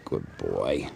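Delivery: quiet praise for a pet; soft praise to cat. Real, not acted. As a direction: murmur it gently to the cat.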